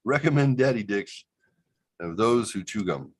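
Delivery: high announcer opening, settling by the end; hammed-up ad voice; mock commercial. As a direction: fake dental endorsement read. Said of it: "another commercial tag".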